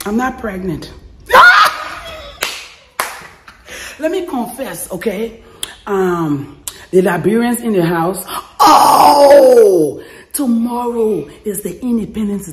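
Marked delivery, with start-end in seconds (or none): 1.3-2.6 loud high cackle; shrieking laugh. Real, not acted. Vlogger joking she's not pregnant, shrieking laugh. Joke reveal. 8.6-10.0 loud high whoop; excited shout. Real, not acted. Vlogger hailing fellow Liberians, excited shout.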